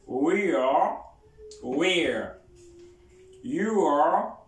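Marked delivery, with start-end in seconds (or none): 3.4-4.4 You are with a rise on you; full form you are. Shown as a demonstration. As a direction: stress you, keep both words. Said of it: "Repeat after me".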